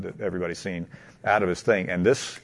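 normal talking voice

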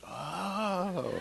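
soft, high, drawn-out oh; dawning understanding. Retold or imitated. Landlord finally understanding tenant means mosquitoes, enlightened. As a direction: let the penny drop slowly.

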